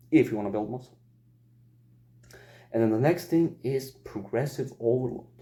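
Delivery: normal talking voice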